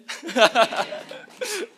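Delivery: high, loud burst of laughter; bright laugh. Real, not acted. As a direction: laugh at your own joke. Laughs after his own amen joke.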